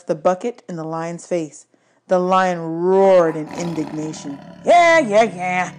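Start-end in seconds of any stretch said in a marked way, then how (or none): 4.6-5.7 loud, high brush-off, fading; dismissive trucker. A narrator's reading. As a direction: wave off the roaring lion. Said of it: "the man said".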